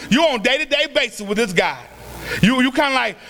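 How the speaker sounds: usual speaking voice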